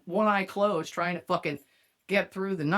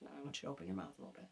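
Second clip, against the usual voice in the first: very soft, lower, quick coaxing; mock seductive murmur; 5 semitones lower, 18 dB quieter, much faster than usual. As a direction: murmur the instruction like a teasing come-on.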